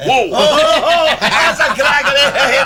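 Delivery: cracking up at the joke; laughing. Real, not acted.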